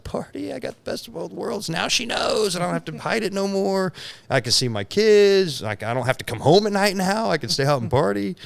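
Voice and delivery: high-pitched gleeful past self; mock party glee. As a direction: play giddy freedom in a sing-out high voice. Acting out his gleeful past self.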